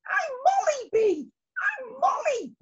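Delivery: very high small bee voice; young bee. Acted. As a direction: answer timidly as a young bee. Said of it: "replied the young bee".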